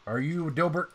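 a worker checking who you are; NPC question. Acted.

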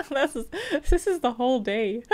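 amused laughing aside. Real, not acted. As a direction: giggle it out, amused. Laughing at the texts.

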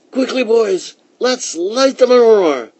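high, loud, eager call; rally the children with high-pitched excitement; eager girl's character voice; excited calling. A narrator's reading. Girl excitedly calls her brothers.

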